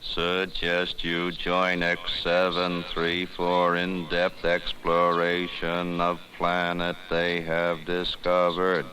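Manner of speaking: slow, even, machine-like monotone; flat slow robotic computer reporting its decision; computer readout voice. Acted. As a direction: read it out like a cold ship computer. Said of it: "Let's ask for a readout on its decision".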